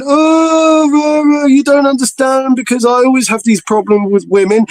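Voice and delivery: high, moaning imitation of the friend; mocking an insecure mate's complaints; whiny mock complaint. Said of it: "he's still going".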